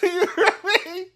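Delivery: laughing. Real, not acted. Laughing after outburst.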